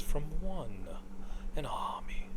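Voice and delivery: imitating the voice line, fading to a whisper; breathy whispered hero line. Fan imitating Phantom Lancer's line in a whisper. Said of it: "Like, the way he says it".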